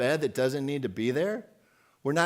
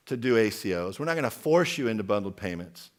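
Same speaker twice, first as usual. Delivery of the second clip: normal talking voice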